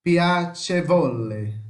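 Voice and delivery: slow "Piacevole", strong on CE; pia-CHE-vo-le, "Piacevole" syllable by syllable; antepenultimate stress. Shown as a demonstration. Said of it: "A antepenúltima". ("Piacevole" devagar, com a antepenúltima sílaba forte)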